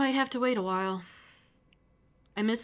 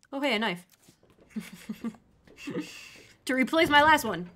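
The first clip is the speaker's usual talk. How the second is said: ordinary talk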